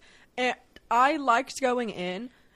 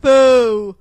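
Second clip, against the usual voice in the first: loud mocking boo; 16 dB louder than usual. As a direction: boo it out loudly. Booing the movie's critics.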